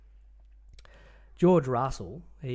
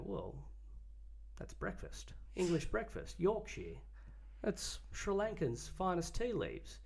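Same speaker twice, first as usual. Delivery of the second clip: a light mock posh London accent, a touch higher and soft; 6 semitones higher, 9 dB quieter than usual. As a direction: lightly send up a refined Londoner talking tea. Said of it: "with his posh London voice".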